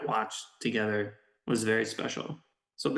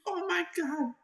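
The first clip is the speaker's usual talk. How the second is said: oh my god disbelief. Retold or imitated. Benji reacts.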